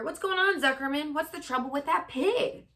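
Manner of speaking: high, loud alarmed announcer. Acted. Announcer sees the pig faint, alarmed loud call. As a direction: sound startled and worried over the speakers. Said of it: "said the loudspeaker".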